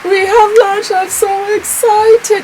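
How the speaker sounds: high-pitched celebratory squeal, words stretched out; excited squeal. Real, not acted. Brand owner celebrating her launch, excited squeal.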